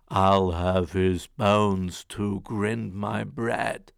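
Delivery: slow, loud ogre chant. Acted. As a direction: boom Fee fi fo fum slowly. Ogre sniffs out Jack.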